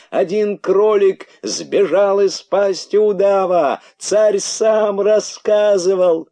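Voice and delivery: высокие, громкие протяжные выкрики; вопи медленно и высоко, как глашатай; доносящиеся крики. A narrator's reading. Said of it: "всё ещё доносился голос дерзкого кролика". (протяжно кричит на все джунгли)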